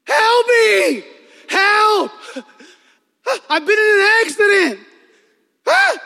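full-out high screams, reenacted; screaming for help. Crash victim calls to a passing driver, screaming. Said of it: "So I just start screaming".

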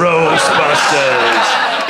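very slow, low title boom; movie trailer voice. Pretend. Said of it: "use the movie trailer voice".

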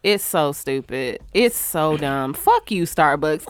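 slow, low fed-up sigh of words; weary disgust. Real, not acted. Host trails off in slow weary disgust at Starbucks. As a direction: drag it out, defeated.

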